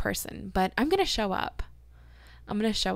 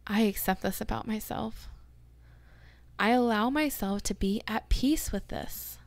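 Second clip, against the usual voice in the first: affirmation reading. Acted. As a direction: read each one like a gentle promise. Reads affirmations aloud.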